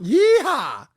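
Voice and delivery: high, loud cowboy yeehaw; yeehaw holler. Pretend. Prince asked for a yeehaw, hollering high and loud. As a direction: whoop it out like a cowboy. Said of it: "Let me hear a yeehaw".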